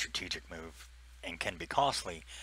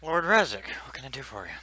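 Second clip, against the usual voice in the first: Rachel answering; 7 semitones higher, 9 dB louder than usual. Acted. GM voices NPC Rachel.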